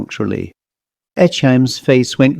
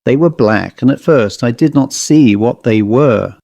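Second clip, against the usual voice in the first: ordinary delivery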